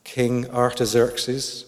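"Artaxerxes" said slowly, syllable by syllable, unsure; slow and careful over "King Artaxerxes"; careful pronunciation. Real, not acted. Preacher tries the hard name "Artaxerxes" slowly. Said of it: "if I've said that right".

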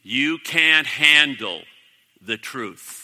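slow, loud, emphatic preacher delivery; forceful preaching. Real, not acted. Preacher warns the congregation about refusing the truth, forcefully. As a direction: drive it home to the congregation, loud and deliberate.

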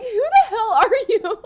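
loud, high, drawn-out mock disbelief; mock outrage. Friend retelling how strangers snubbed him, mock outrage. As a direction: imitate strangers loudly taken aback.

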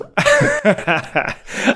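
high-pitched burst of laughter. Real, not acted. Laughs at own joke.

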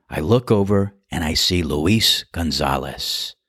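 normal talking voice

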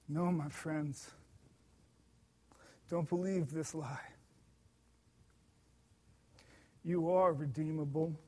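reassuring. Real, not acted. Preacher consoling doubting listeners, softly reassuring. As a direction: gently lift them up like a pastor consoling.

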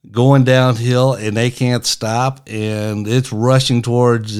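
ordinary delivery

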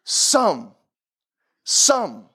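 SOME set alone and drawn out, falling; stress on SOME. Shown as a demonstration. Preacher showing which word Hillel emphasized, stress on SOME. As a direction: land heavily on "some", pause after it. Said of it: "he emphasizes the word some".

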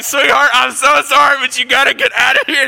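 high, loud begging to his wife; hammed-up desperate plea. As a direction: plead at the top of your voice, mock distress. Sick husband begs food be removed.

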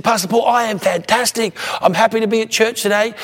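lightly acted, high, emphatic enthusiasm; imitating loud talker. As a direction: mimic an eager churchgoer at one unvarying volume. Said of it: "talk at one volume loud".

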